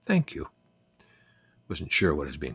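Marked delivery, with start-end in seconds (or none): 0.0-0.5 high-pitched English lady's voice; gentle, high English accent; quiet English accent. A narrator's reading. English neighbour thanking the boy who hugged her, quiet English accent. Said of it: "she said quietly".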